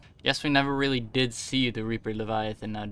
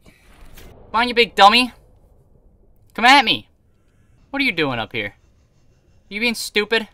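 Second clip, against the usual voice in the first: high, loud mocking taunts; taunting shout; 8 semitones higher, 9 dB louder than usual. Real, not acted. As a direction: jeer at the monster. Taunting the Reaper in battle.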